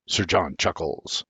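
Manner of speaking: plain reading voice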